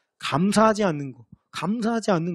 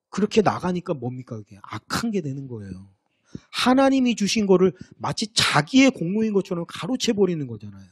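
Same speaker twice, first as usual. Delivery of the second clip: usual speaking voice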